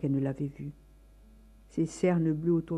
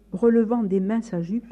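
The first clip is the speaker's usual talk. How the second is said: plain reading voice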